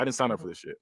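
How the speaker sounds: low, soft fed-up grumble; understated mock disgust; pissed off, retold. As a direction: mutter it as someone who is done, lightly imitated. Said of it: "so he's pissed off".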